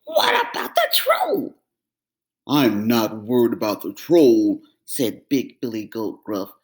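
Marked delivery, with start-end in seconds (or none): 0.0-1.6 worried cry. Acted. Middle goat voice. 2.5-4.6 big brother goat, unbothered; calm big goat. Acted.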